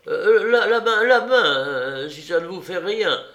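high, loud, nervous plea; frightened stammer. A narrator's reading. Bravida begs the leader for a hand, nervously stammering. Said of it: "qui avait très peur des armes chargées".

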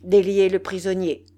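loud, firm command; loud cry on an even pitch; chief's shouted order. A narrator's reading. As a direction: order the men with full authority. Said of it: "cria le chef".

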